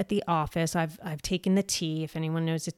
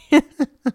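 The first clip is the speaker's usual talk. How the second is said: teary laugh; 9 semitones higher, 12 dB louder than usual. Real, not acted. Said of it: "Okay, I'm gonna cry".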